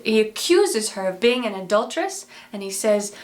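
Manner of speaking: ordinary delivery, nothing marked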